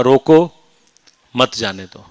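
روکو loud on its own, a pause, then مت جانے دو together; pause after روکو. Shown as a demonstration. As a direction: punch روکو, break, then drop into مت جانے دو. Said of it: "کومے کو آپ نے ایک لفظ پہلے لگا دیا".

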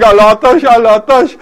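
loud, plaintive retold cry; imitated crying children. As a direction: wail it out in the children's place. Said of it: "your children are crying".

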